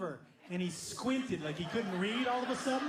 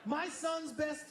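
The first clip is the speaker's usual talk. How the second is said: ordinary talk, nothing marked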